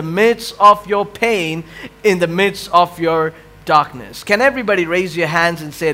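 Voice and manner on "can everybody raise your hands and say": normal talking voice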